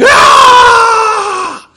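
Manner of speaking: loud, high, long scream; piercing shriek from down a tunnel. Acted. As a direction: let out a full monster scream. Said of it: "you hear screaming".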